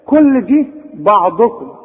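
ordinary delivery

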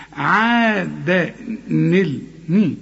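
very slow عادا نل ني, breaking down the ni sound; joined reading. Shown as a demonstration. Teacher breaks the word down for the student. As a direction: spell out عادا ني syllable by syllable. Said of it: "بتخلص من الساكن الأولاني".

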